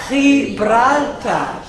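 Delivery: "Gibraltar" drawn out, with a throaty H for the G; Spanish pronunciation. Shown as a demonstration. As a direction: say "Gibraltar" the Spanish way, slowly, starting "khee". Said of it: "it is called".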